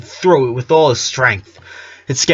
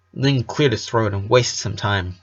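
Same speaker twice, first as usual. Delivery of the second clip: ordinary delivery, nothing marked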